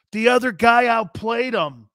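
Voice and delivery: loud emphatic rant; shout. Real, not acted. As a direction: yell it in frustration. Ranting at broadcast.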